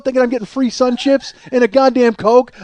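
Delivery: usual speaking voice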